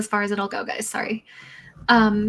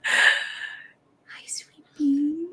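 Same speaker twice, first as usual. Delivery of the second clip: awed whisper to a pet; 10 semitones higher, 4 dB louder, much slower than usual. Real, not acted. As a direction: whisper hello to a shy cat. Greeting the cat softly.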